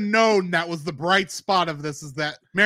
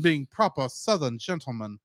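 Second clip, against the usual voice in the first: Southern gentleman; 6 semitones lower, 4 dB quieter than usual. Retold or imitated. Host describing Mark Twain role-play, in a mock Southern accent. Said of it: "pretending to be Mark Twain".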